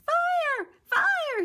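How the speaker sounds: very high loud shout; crying fire. Acted. Jack tries to scare the birds off, high shout. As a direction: yell it out in alarm. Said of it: "he cried".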